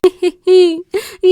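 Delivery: loud high scornful laugh; mocking laugh. A narrator's reading. Nanny laughs at the fallen boy, loud mocking laughter. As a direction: laugh at him with contempt. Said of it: "فضحكت بسخرية".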